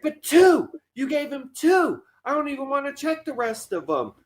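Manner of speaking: high-pitched, appalled mother imitation; mock outraged mom. Voices mother finding more fakes.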